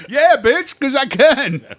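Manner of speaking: very loud, high-pitched retold comeback; hammed-up shouted retort; defiant shout. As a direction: fire it back at full volume. Retold comeback to buddy's taunt.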